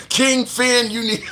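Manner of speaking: laughing while talking; amused laughter. Real, not acted. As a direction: crack up reading out a comment. Cracks up at viewer comment.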